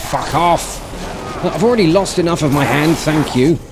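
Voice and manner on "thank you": usual speaking voice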